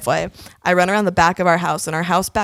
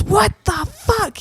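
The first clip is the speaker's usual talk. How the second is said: high, drawn-out disbelief; slow, high-pitched outrage without shouting; outraged dad; 13 semitones higher, slower than usual. Retold or imitated. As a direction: imitate an appalled dad, stretching each word. Dad woken by banging at door.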